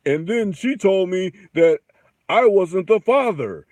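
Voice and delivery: high, loud, dragged-out mock testimony; scripted talk-show guest. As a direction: ham it up like a paternity-show guest. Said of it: "nobody talks like that".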